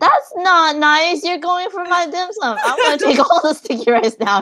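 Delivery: high, playful protest; teasing complaint, not in earnest; mock indignation. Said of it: "No, I'm just kidding".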